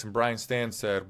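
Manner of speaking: ordinary talk, nothing marked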